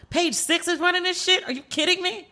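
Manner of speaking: retelling her own shocked reaction, high; incredulous outburst. Retold or imitated. Recalls reacting to Page Six.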